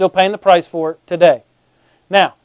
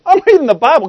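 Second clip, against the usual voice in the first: higher-pitched defensive comeback; protesting retort; 6 semitones higher, 4 dB louder than usual. Acted. Preacher answering a shocked church member, protesting. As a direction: protest your innocence, pitch jumping up.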